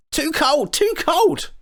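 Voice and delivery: very high squeaky creature cries; crying out. A narrator's reading. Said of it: "they cried".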